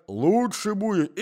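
put-on high, slow old man's advice; drawn out, doddering; forgetful old husband to wife, high and slow. A narrator's reading. Old man repeats.

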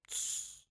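hushing shush. Acted. Young man under the bed silences the other, whispered shush. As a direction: shush a panicking companion. Hidden man hushes sneezer.

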